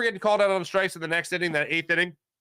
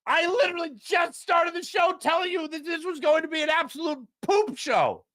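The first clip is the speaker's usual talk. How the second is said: shout; 11 semitones higher than usual. Real, not acted. Ranting about predicted mess.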